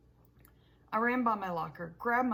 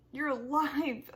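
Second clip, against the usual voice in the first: slow, louder joyful cry; shouted joy; 5 semitones higher, 5 dB louder, slower than usual. Acted. Boy jumping into his dad's car: relieved shout. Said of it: "I shouted".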